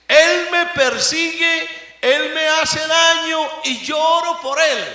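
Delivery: loud, high impassioned preaching; preaching at full force. Acted. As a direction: thunder it from the pulpit. (predicando a todo pulmón)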